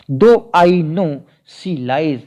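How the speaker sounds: very slow, deliberate reading of the verse; unhurried verse reading; slow poem recital. Acted. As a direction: lay each word of the poem out for the class. Recites the sonnet line.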